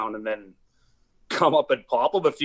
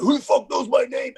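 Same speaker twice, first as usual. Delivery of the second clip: high-pitched imitation of an angry yell; retold scream; 10 semitones higher, 4 dB louder than usual. Retelling the guy who charged at him, mock screaming. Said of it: "just came screaming at me".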